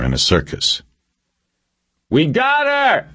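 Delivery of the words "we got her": high, drawn-out yell into the silence; triumphant yell. A narrator's reading. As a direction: yell it out slowly to a whole crowd. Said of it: "he yelled into the silence".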